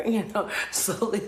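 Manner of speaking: high laughter through words; laughing. Real, not acted. Describing the freeze, laughing through words. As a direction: laugh while you say it.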